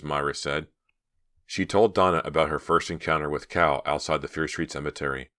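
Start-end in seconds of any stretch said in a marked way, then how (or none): none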